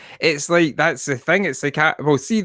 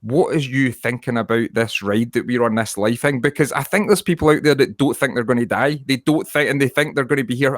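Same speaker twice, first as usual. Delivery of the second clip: normal talking voice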